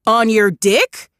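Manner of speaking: wife throwing his words back; outraged cry. A narrator's reading. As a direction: spit it back at him. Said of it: "Rachel cried".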